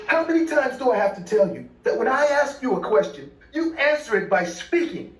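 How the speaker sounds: high, scolding acted scream; angry screaming. A narrator's reading. Father screaming at his silent little girl, angry. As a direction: scream a high-pitched telling-off. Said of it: "began screaming at her".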